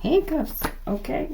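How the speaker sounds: high-pitched, voice jumping up; voice going high. Real, not acted. Card reader announcing handcuffs to the collective, voice going high. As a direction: let the pitch shoot up involuntarily. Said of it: "my voice went up to a high pitch".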